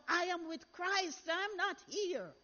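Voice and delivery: voice of God. Retold or imitated. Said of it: "it's like a voice said to me".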